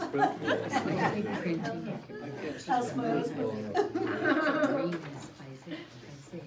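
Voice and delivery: long, rolling laugh; real, sustained laughing; amused laughter. Real, not acted. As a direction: laugh along at the joke. Amused by whiny voice joke.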